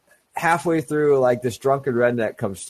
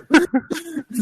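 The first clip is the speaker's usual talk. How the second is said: 大笑い; high burst of laughter. Real, not acted. Laugh at own story.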